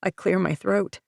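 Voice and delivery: usual speaking voice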